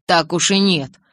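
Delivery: ordinary delivery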